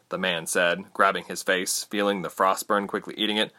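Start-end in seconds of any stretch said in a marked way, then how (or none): none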